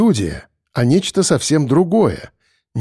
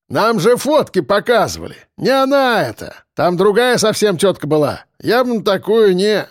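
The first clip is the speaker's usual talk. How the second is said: higher-pitched, lively objection; calling back in protest; 6 semitones higher than usual. A narrator's reading. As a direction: protest to a mate from the bushes. Henchman calls back from the bushes.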